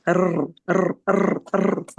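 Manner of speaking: rolled rrr trills, tongue buzzing; vibrating tongue trill. Shown as a demonstration. Teacher showing the Spanish trill, repeated rolled rrr. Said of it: "like that, to get that sound".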